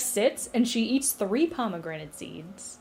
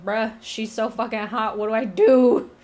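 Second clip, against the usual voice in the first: retold lovestruck panic, bursting louder at the end; mock panic; 6 dB louder than usual. Hades panics over a woman he finds hot, mock frantic.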